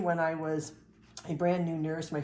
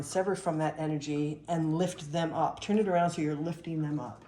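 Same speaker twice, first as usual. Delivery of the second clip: usual speaking voice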